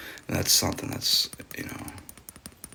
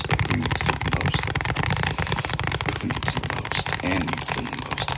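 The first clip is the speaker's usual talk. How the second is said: ASMR whisper. Acted. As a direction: tease the viewers in a hushed voice.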